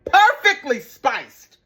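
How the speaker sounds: Perfectly high and loud, drawn out slowly; delighted slow emphasis; slow emphatic praise. Real, not acted. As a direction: savour Perfectly spiced word by word. Praising taste.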